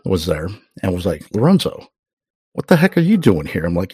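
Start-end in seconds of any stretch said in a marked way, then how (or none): 1.3-3.6 higher, louder manager's surprise; imitated boss's startled hello; surprised greeting. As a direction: call out in amazement on spotting an old employee. Manager surprised to see ex-employee.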